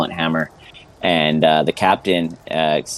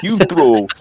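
thug's shout; 12 semitones higher, 5 dB louder than usual. Acted. Thug shouting while throwing someone.

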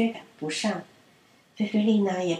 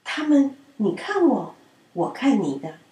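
plain reading voice

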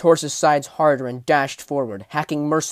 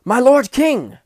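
high, loud desperate call; crying out; 11 semitones higher, 11 dB louder, slower than usual. A narrator's reading. Soldier calls to the distant king, high loud cry. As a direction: shout to be heard across the battlefield. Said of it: "cried the soldier".

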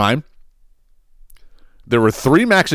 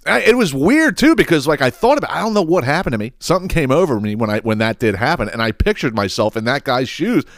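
ordinary talk, nothing marked

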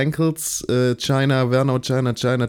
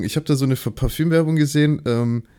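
normal talking voice

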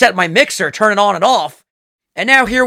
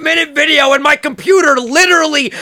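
exasperated, raised, higher voice; frustrated rant; 6 semitones higher than usual. Real, not acted. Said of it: "Every fucking week we run into a technical difficulty".

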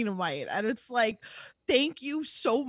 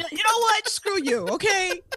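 mock outrage; 7 semitones higher, 4 dB louder than usual. Mock fight ending.

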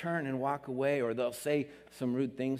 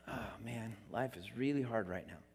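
weary honest reply; 4 semitones lower, 11 dB quieter than usual. Pretend.

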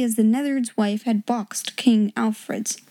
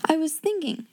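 put-on high, composed girl's voice; grand and polite; 6 semitones higher, 4 dB quieter than usual. A narrator's reading. Pupil answering the headmistress, grandly polite. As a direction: answer with lofty courtesy. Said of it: "she answered grandly and politely".

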